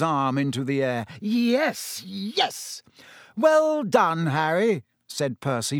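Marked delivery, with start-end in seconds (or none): 1.2-2.8 high, contained yells of triumph. A narrator's reading. Ron raising Harry's arm, yelling in triumph. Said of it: "Ron yelled". 3.4-4.8 high, delighted congratulation. A narrator's reading. Percy congratulating Harry, delighted. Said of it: "looking delighted".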